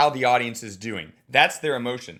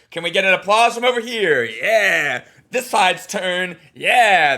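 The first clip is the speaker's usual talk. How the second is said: louder, higher playful host; mock host crowd game; 4 semitones higher, 7 dB louder, slower than usual. Coach demonstrating a crowd game, playful and loud. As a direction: work the room side by side. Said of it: "Then it's like".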